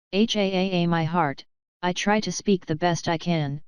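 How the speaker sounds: ordinary delivery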